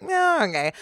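high, mock-reluctant shrug; shrugging acceptance. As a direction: give in with a vocal shrug. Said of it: "like, shrug shoulders emoji".

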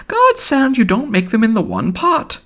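put-on very high-pitched wheedling old woman; Missus Cahill. A narrator's reading. Neighbour teasing old tea-maker, high wheedle. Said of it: "went on hewing and wheedling".

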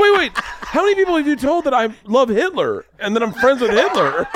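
very high retold panic, half laughing; acted horrified parent; alarmed disbelief. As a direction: voice climbing in comic alarm. Alarmed parent questioning child.

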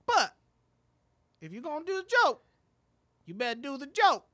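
high, loud insistent comic voice; impression of fellow comic. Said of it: "He was like".

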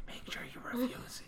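leaning in to whisper; hushed aside, no voice. Real, not acted. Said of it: "I just gotta whisper".

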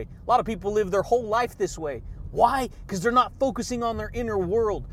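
ordinary delivery

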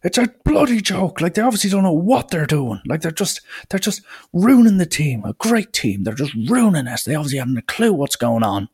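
high, strained mock rant; imitating ranting parent. Mimicking a parent ranting at the coach. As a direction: mimic an angry parent screaming. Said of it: "proceeds to start screaming and screaming".

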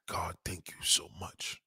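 slow, whispered thanks to God; hushed, overcome gratitude, acted out; retold tearful prayer. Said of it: "And as I'm crying".

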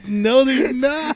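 amused, drawn-out refusal; laughing reply. Retold or imitated. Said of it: "I was like".

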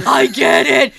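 put-on loud burst of childlike realisation; excited kid. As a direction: blurt it out like a thrilled kid. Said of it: "And the kid's like".

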